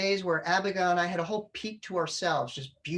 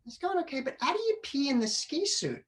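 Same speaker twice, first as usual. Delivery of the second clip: put-on much higher, innocent kid question; small child voice; 8 semitones higher than usual. Dad voices his young son asking, high and innocent. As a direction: pitch way up like a puzzled little boy.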